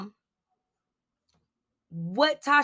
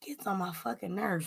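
whispered, fed-up aside; annoyed whisper; 8 dB quieter than usual. Real, not acted. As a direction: hiss it under your breath like a secret gripe. Venting about [name].

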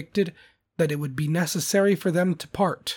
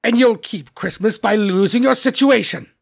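loud, raised warning to the clerk; threatening Scrooge; 5 semitones higher, 7 dB louder than usual. A narrator's reading. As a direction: threaten him with the sack. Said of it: "said Scrooge".

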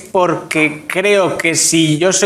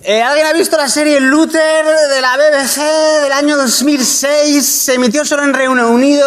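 showman announcement; 7 semitones higher than usual. Pretend. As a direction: present it like a stage emcee. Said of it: "decía el título con acento británico". (presentador de escenario, agudo y lento)

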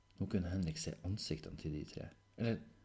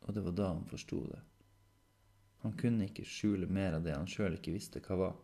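usual speaking voice

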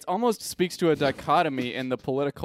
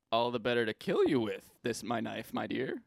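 soft, calm killer voice; quiet menacing psychopath; 3 semitones lower, 7 dB quieter than usual. Acted. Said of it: "can we each do our best psychopath impression".

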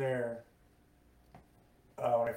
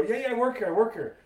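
high, louder mock-panicked insistence; retold frantic protest; 9 semitones higher, 4 dB louder than usual. Employee answering a suspicious sheriff, retold frantic protest. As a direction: insist urgently, as if caught by police.

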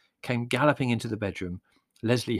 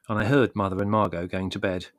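ordinary delivery

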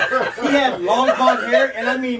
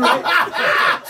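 higher, louder laughing question; 8 semitones higher, 6 dB louder than usual. Real, not acted. Telling friends about his dad at Saturday school, laughing.

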